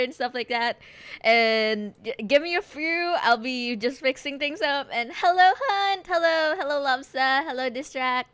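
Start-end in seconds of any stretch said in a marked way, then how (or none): none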